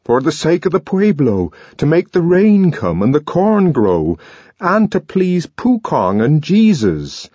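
high, loud building conviction; impassioned declaration; fervent. A narrator's reading. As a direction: build to a proud yes. Young man explains proudly.

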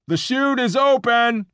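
high, slow, loud yell. Acted. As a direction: shout it out over the room. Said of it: "he yelled".

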